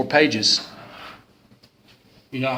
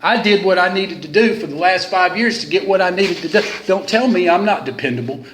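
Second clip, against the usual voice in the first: put-on defiant drunk; 6 semitones higher than usual. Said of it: "him saying that to the dude".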